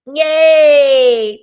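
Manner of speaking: loud, high, drawn-out cheer; excited cheer. Real, not acted. Host answering Aaron's comment, loud excited cheer. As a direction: cheer out loud on one long note.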